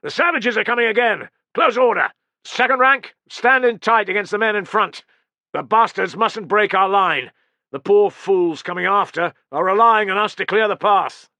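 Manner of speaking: loud, high battle orders; shouted commands. Acted. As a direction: bark orders down the line. Centurion commands ranks before charge.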